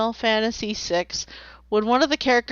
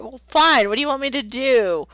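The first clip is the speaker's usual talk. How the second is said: normal talking voice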